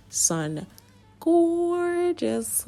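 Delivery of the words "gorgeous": high, gushing admiration; excited gush. Real, not acted. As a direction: gasp it out in delight. Admiring finished nails.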